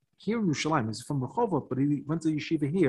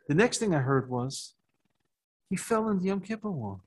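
ordinary talk, nothing marked

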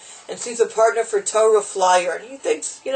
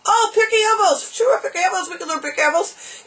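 high, eager Yiddish accent imitation; thick Yiddish accent; 11 semitones higher than usual. Chassid agreeing to learn with phone partner, excited Yiddish accent. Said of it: "You know, the stick Yiddish accent".

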